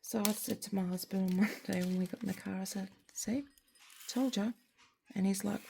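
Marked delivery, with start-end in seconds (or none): 3.1-4.5 told-you-so. Retold or imitated. Gloating to husband in the car.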